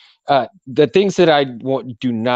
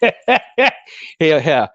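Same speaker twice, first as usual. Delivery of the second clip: laughing; 5 semitones higher, 3 dB louder than usual. Real, not acted. Laughing at own teasing.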